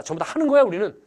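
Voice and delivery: usual speaking voice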